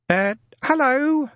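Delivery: put-on high, slow, hesitant young man; tentative hello. Newton phoning about the ad, tentative and high. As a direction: ease into it nervously. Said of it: "he began tentatively".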